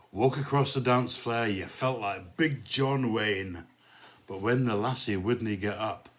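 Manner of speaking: rhythmic poem recital in put-on Glasgow accent; amateur Glasgow accent for a dance-hall poem; bad Glasgow accent. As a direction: recite comic verse in broad mock Glaswegian. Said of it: "sorry about the appalling Glasgow accent".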